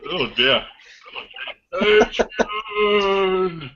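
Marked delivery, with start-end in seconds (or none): 1.7-3.7 high, moaning undead voice; zombie. Retold or imitated. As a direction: groan the name like a zombie. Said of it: "You sound like a zombie".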